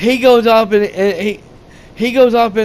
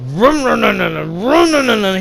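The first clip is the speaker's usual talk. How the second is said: rum rum rum like an engine revving up and down; imitated chainsaw revving. Retelling the movie, mimicking a chainsaw starting up. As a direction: rev the chainsaw with your mouth.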